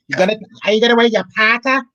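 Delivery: usual speaking voice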